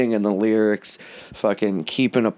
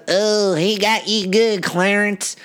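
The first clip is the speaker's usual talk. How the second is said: bystander voice; 11 semitones higher, slower than usual. Retold or imitated. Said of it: "And so guy goes".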